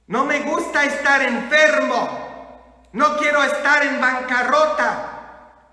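loud, high, slow emphatic exhortation; forceful preacher's charge; fired-up preaching. Real, not acted. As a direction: hammer each phrase to the congregation.

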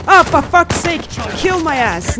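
high, loud cry of frustration; exasperated shout. Real, not acted. Player killed in the game, exasperated yelling. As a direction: explode at the game.

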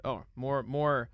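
normal talking voice

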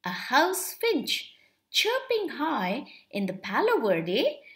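usual speaking voice

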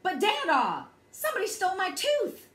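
high, fast, insistent half-shout; loud protest climbing in pitch; kinda shouted. A narrator's reading. Child insists to Dada somebody stole the tooth, kinda shouted. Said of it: "I kinda shouted".

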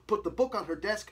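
plain reading voice

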